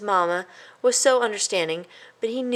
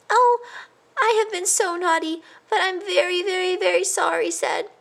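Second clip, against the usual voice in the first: high tearful confession; crying; 12 semitones higher than usual. A narrator's reading. A guilty mouse tearfully confesses to his cousin. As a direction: sob out the apology. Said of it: "cried Christopher".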